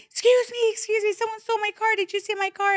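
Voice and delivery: very high, hurried pleading; retold near-tears panic. Crying driver stopping a security patrol, retold panic. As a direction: flag them down in a high, acted-out panic. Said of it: "like, starting to cry".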